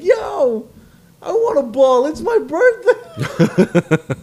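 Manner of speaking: high, drawn-out retold begging shout; hammed-up plea for a ball; pleading call-out.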